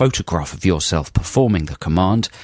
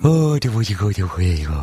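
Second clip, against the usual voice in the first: cat voice. Pretend. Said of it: "Is that your cat voice".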